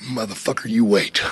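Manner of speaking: low, quiet retold threat; retold anger. [name] silently vowing payback, low retold threat. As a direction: mutter it low, imitating pent-up anger. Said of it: "I was pissed".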